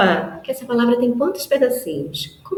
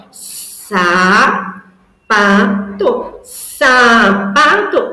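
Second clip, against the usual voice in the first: very slow, louder, each syllable apart; syllable demo; 4 dB louder, much slower than usual. Shown as a demonstration. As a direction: build the new word slowly for a child. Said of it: "Me ajuda, ó".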